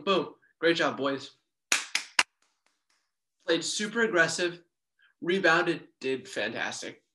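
ordinary talk, nothing marked

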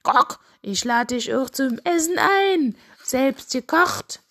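high rooster voice with a cluck; coaxing rooster. Acted. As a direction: invite her grandly as a cartoon rooster. Rooster invites hen to stay.

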